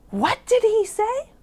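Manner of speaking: high, loud frog outcry; astonished cry. A narrator's reading. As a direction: cry out in disbelief as the startled frogs. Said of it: "cried the frogs".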